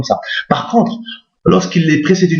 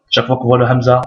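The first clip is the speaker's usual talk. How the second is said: ordinary talk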